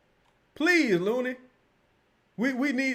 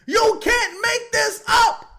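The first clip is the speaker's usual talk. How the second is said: disbelieving shout; 13 semitones higher, 6 dB louder, much slower than usual. Real, not acted. Ranting at a turnover.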